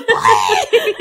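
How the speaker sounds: shrill mock yell at them. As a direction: yell it out, laughing. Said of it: "she literally yells at them".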